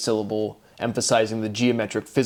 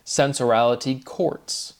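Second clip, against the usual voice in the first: ordinary talk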